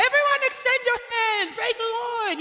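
high, loud call to the crowd; leading worship. Retold or imitated. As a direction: call the room to praise. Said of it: "and I was like".

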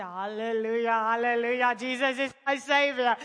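loud hallelujahs in a put-on voice; comic church-style outburst; jubilant praise. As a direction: proclaim it loudly, hammed-up with relief. Retold outburst of praise, offered tour.

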